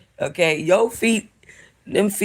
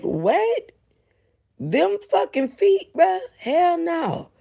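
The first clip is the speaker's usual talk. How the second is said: disgusted disbelief; 10 semitones higher, slower than usual. Real, not acted. Disgusted at the foot.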